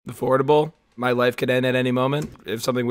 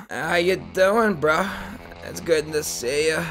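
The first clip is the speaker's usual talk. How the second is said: imitating a slow surfer bro; out-of-breath surfer bro; slower than usual. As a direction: draw the words out like a winded bro. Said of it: "He always acts like he's out of breath".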